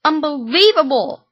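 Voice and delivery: outraged shout. Acted. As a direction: fling it out in outrage. Son outraged, on his feet.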